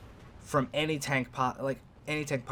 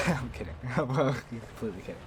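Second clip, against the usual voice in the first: laughing. Real, not acted. Jokingly takes back a threat.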